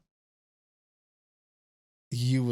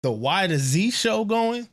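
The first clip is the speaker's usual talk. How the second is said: eager pitch; 5 semitones higher, 6 dB louder than usual. Retold or imitated. Quotes own eager request to start show.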